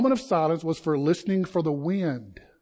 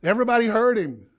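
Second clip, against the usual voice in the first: ordinary talk, nothing marked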